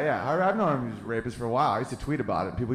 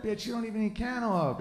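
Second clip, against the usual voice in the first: higher, softer sneering rooster taunt; cocky rooster; 8 semitones higher, 5 dB quieter than usual. Retold or imitated. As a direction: taunt like a smug little rooster. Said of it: "he's looking at me like".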